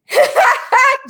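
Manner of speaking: real laugh bursting out, high and loud; high burst of laughter. Real, not acted. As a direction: break out laughing at the comment. Laughing at Jamie's comment.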